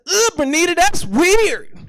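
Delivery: high, loud comic outburst; acted-out loud reaction of disgust; loud retold blurt. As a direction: blurt it out like an accidental shout.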